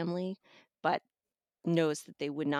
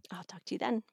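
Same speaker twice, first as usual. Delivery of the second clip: whisper; 2 semitones higher, 3 dB quieter, faster than usual. Real, not acted. Guest whispers goodbye back.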